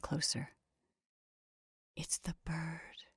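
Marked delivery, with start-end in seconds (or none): 1.9-3.0 slow, hushed whisper. A narrator's reading. Woman tells her friend of a shocking find, whispering. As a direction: whisper it slowly, barely voiced. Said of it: "she whispered".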